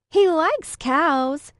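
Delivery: loud, high, slow mocking call down the hall; yelled taunt. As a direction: drag it out to taunt her. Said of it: "Lexi yelled after me".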